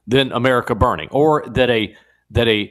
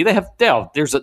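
ordinary delivery

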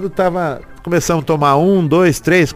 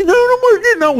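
high denying voice; faster than usual. Retold or imitated. Opponent's voice denying.

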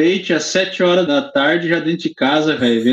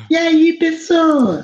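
silly high voice; 13 semitones higher, much slower than usual. Pretend. Goofy voice after laugh.

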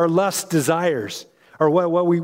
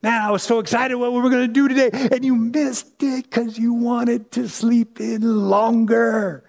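higher, let-down voice, gently scolding; disappointed Holy Spirit; 4 semitones higher than usual. Retold or imitated. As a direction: play the Spirit sighing over a sleepy pastor. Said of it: "sometimes I think he's like".

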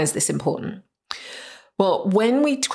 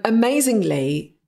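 ordinary delivery

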